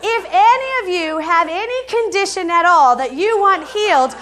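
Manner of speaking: high, loud, emphatic appeal to everyone; impassioned announcement. Retold or imitated. Flight attendant urging passengers to come forward, impassioned announcement. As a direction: proclaim it loudly to a whole cabin, pitched high.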